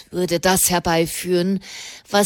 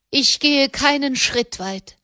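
said loudly; 6 semitones higher than usual. A narrator's reading. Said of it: "Katharina sagte laut".

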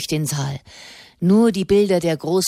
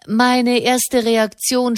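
deliberate opening; 6 semitones higher, 4 dB louder, slower than usual. A narrator's reading. Orator pausing for effect.

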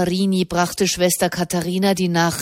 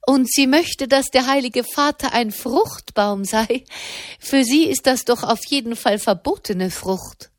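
amused lady; 6 semitones higher than usual. Acted. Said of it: "rief Madame erstaunt".

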